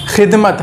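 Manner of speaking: खिदमत with a rasping kh from the throat, like Urdu ख़; throaty kh sound. Shown as a demonstration. Teacher shows students a throaty खिदमत. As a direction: scrape the kh of खिदमत at the back of the throat. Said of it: "इसके आवाज़ में भी फ़र्क होगा".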